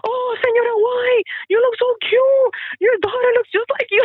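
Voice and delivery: put-on very high, loud squealing teen girls; squealing students. Teacher imitating students gushing over her old photos, loud squealing. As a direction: shriek it like thrilled schoolgirls. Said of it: "They're like".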